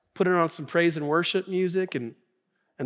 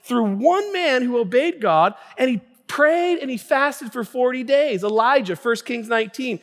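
rising from firm to high, loud fervor; building preaching; 7 semitones higher, 5 dB louder than usual. Real, not acted. Pastor citing Moses and prayer to congregation, building preaching. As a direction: build momentum, leaning hard on key words.

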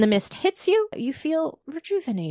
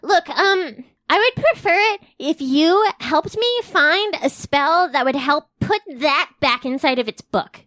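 character voice; 6 semitones higher, 3 dB louder than usual. Acted. Asteria voiced asking for help.

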